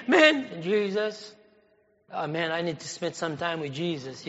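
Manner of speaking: sleepily mocking someone who is too tired for God; drowsy mock sigh. Preacher imitating a tired believer, softly and drowsily.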